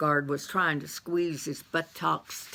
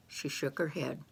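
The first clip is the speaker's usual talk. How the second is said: plain reading voice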